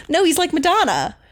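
high-pitched, stubborn comeback; mock-indignant contradiction; insistent retold protest. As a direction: argue back, pitched well up. Retold argument with dad.

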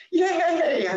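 high, loud cheer of relief; mock cheer of joy at being allowed to be happy; excited yay. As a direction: squeal it like a delighted kid.

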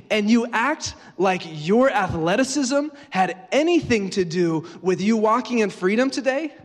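outraged scolding, loud and drawn out; high, forceful acted indignation; indignant wife. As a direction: rebuke him as the wronged wife, stretching each accusation. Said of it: "What would Ben's wife say?".

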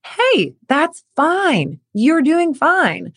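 calm them, nothing is wrong; reassuring. Pretend. Parent reassuring an athlete, slow and easy. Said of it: "find that to be like".

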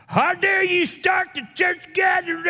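high, loud indignant shout; retold angry congregant. Upset congregant confronts pastor after service, shouting. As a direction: blast him with outrage. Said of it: "came out mad as a wet hen".

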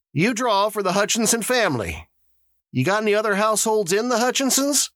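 high-pitched official addressing the crowd; high character voice for announcements; Mister Summers, the lottery official. A narrator's reading. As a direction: voice an organizer moving everyone along.

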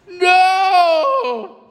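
very high mock bawl; tearful child. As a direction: imitate a small girl wailing harder. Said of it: "And tears".

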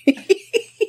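high mocking cackle; a sharp high laugh at the sister; shrill mock laugh after the toast.

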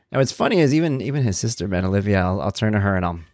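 usual speaking voice

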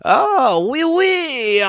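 high, loud French teasing with exaggerated laugh; mock French taunting. Teasing French people to get a rise, loud mock French. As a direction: goad them with a hammed-up, high-pitched French cry. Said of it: "looking at a French person and going".

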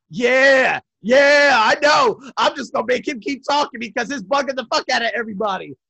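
loud, high, gleeful sarcasm; punchy mock-agreeing yeah, sarcastic. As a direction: imitate a brother egging it on through a grin. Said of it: "with a sarcastic grin on his face".

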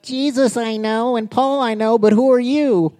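high-pitched little demon voice; comic high wuss voice; wussy demon. Acted. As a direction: play the evil spirit as a wimp. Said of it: "That's how he really sounds, a little wuss".

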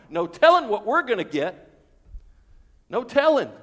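gleeful, itching for a big payday; eager workers. Acted. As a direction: workers rubbing hands over their wages. Workers imagining big pay.

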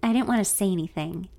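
ordinary delivery, nothing marked

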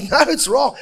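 high, loud, comic rebuttal; hammed-up high-pitched refusal; insistent contradiction. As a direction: contradict loudly with a laugh in the voice. Acted back-and-forth over the choice.

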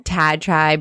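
ordinary delivery

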